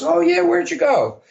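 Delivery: imitating a boss's surprised, eager question; curious boss. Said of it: "And he goes".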